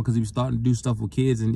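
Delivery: ordinary talk, nothing marked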